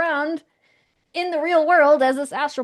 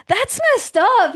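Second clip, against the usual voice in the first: high, loud, drawn-out disapproval; appalled; 10 semitones higher, 9 dB louder, slower than usual. Retold or imitated. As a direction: react aghast on someone's behalf, stretching the words. Acts out stranger's indignant reaction.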